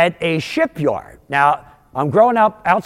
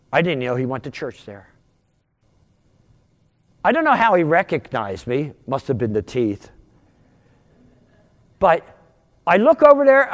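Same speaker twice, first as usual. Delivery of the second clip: usual speaking voice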